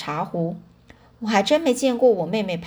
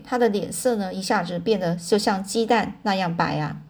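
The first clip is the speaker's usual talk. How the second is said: ordinary delivery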